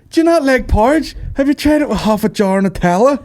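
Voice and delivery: high, hammed-up incredulous questioning; mock porridge enthusiast. Selling porridge to a doubter, in high mock disbelief. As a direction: pitch it up like an over-keen food pusher.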